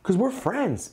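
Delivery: high eager voice asking fans for feedback; friendly ask. Retold or imitated. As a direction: ask fans like you're texting a buddy.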